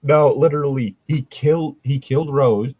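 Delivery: ordinary talk, nothing marked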